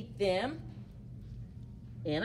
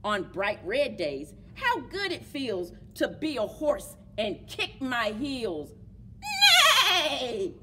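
put-on reading to children. A narrator's reading. Teacher reading My Many Colored Days, storytime voice. Reads picture book aloud.

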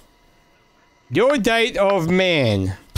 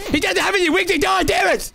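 frustrated yell; 11 semitones higher, faster than usual. Real, not acted. Losing at Flappy bot.

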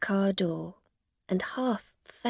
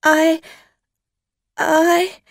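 high, loud frightened stammer; afraid; 9 semitones higher, 11 dB louder than usual. A narrator's reading. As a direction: falter in fear. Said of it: "Dorothy Burns was suddenly very afraid".

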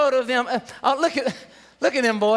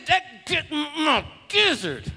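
slow, strained mock fury; mad; much slower than usual. Preacher showing how mad one can get, angry. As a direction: force it out slowly as if about to burst. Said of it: "so mad that it got your gizzard".

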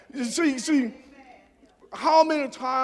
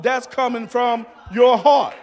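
shouted refrain. Acted. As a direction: build to a shout and hit "heart".

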